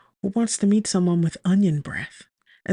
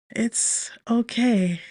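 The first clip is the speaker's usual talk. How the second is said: slow, higher, breathy reassurance; 5 semitones higher, much slower than usual. A narrator's reading. Flustered woman answers handsome stranger, slow and breathy. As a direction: breathe it out slowly, dazed. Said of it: "I say breathily".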